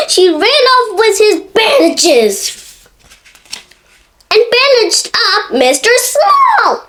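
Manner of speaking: gasping, then chuckling; gasp then laughing. A narrator's reading. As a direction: laugh through the reveal.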